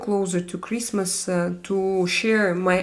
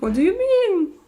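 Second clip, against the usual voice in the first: surprised; 10 semitones higher, 3 dB louder than usual. Real, not acted. Reacting to a viewer's comment.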